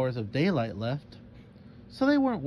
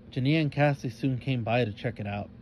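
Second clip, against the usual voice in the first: usual speaking voice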